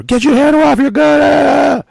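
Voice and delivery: high, loud yelled command; shouted order. Retold or imitated. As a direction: yell it out, high and sustained.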